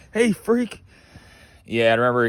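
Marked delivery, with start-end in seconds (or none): 0.1-0.8 a light hint of the drill instructor; fond echo of the catchphrase. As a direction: fondly mimic the old catchphrase. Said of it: "I love that".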